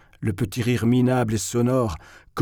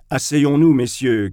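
loud call to the table; appel crié; 3 semitones higher, 5 dB louder than usual. Acted. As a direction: call everyone to sit, loud. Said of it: "cria Simonov". (appel fort aux convives)

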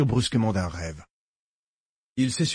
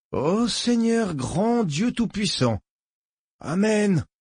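slow, high fervent prayer; 9 semitones higher, slower than usual. A narrator's reading. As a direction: pray it out slowly, rapt. Sutter prays in rapture.

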